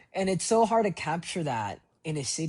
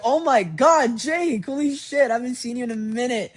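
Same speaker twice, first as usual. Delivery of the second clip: loud, high-pitched shout of recognition; excited yelling; 11 semitones higher, 11 dB louder than usual. Retold or imitated. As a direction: yell greetings to a long-unseen friend. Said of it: "I, like, I was yelling".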